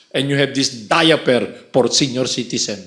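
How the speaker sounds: ordinary delivery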